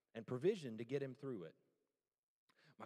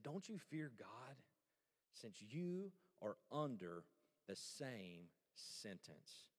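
mild rebuke; 5 semitones lower, 5 dB quieter, much slower than usual. Retold or imitated. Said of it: "the other criminal rebuked him. He said".

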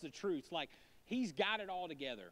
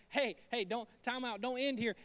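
louder, urgent stop; Jesus calling time out; 5 semitones higher, 5 dB louder than usual. Retold or imitated. Jesus stopping the excited Pharisee, loud and urgent. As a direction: halt him mid-thought.

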